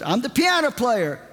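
high, emphatic woman's voice, imitated; insistent caller. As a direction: mimic a woman announcing herself on the phone. Imitated caller identifies herself.

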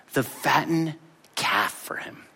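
slow, soft, hushed resentment; bitter whisper. Retold or imitated. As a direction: spit it out under the breath. Bitter brother's complaint.

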